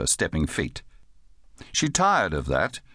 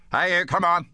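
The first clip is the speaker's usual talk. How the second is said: higher-pitched, brusque summons; calling a dog; 9 semitones higher than usual. Acted. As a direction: call out as if ordering a dog to heel. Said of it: "he called as a man might call a dog".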